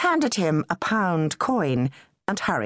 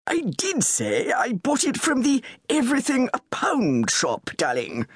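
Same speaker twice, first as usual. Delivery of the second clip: a little tetchy; 6 semitones higher than usual. A narrator's reading. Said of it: "said a little tetchily".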